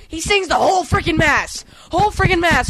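high, exasperated rant; excited rant. Real, not acted. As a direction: vent about it, voice up high. Ranting about singing priest.

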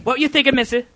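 high, quick English-accent put-on; attempted English accent. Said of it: "That was my English accent".

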